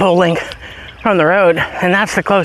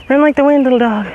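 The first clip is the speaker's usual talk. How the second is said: cheering dog on; 7 semitones higher, faster than usual. Real, not acted.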